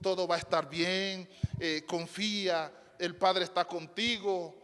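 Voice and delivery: soft, gentle comforting voice; calm him down softly like a caring angel; an angel softly comforting a frightened Jesus; tender reassurance. Retold or imitated. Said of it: "yo me imagino le diría".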